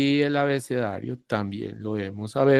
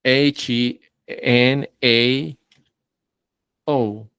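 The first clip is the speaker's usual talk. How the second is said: corrected spelling; much slower than usual. Shown as a demonstration.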